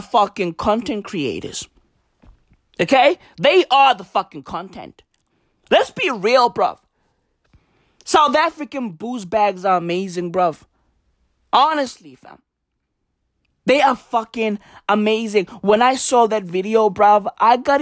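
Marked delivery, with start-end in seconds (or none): none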